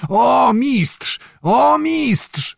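high, ringing cry of delight; admiring shout. A narrator's reading. Zagłoba cheers the master swordsman, high delighted cries. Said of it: "wołał Zagłoba".

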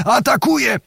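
sudden high cry; Zagłoba cries out in surprise and excitement, pitched high; excited exclamation. A narrator's reading. Zagłoba shouts as the knight attacks, excited and high. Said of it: "wykrzyknął Zagłoba".

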